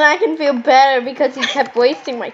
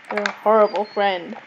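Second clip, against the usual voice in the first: ordinary talk, nothing marked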